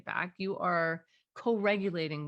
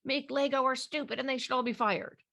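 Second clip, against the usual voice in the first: higher, a hint of a grumbling kid; child's complaint; 6 semitones higher than usual. Child complaining about Lego makers, lightly voiced grumbling. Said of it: "So the kid says".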